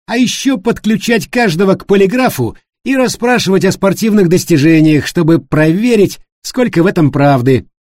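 high, loud, overeager mock suggestion; mocking sarcasm. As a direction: pile on the absurd idea with fake enthusiasm. David mockingly piles on absurd suggestions. (громкая издевательская поддержка, высоким голосом)

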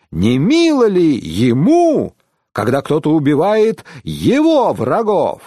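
high-pitched, emphatic oratory; impassioned pilgrim holding forth; exclaiming. A narrator's reading. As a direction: argue it as a ringing rhetorical challenge. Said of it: "воскликнул один из паломников".

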